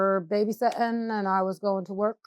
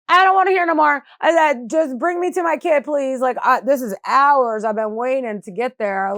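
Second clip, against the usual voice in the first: loud, high outburst, easing toward the end; retold desperate plea; 5 semitones higher, 8 dB louder than usual. Mother demanding to see her child, desperate retelling. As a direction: re-enact cutting someone off, raised and urgent.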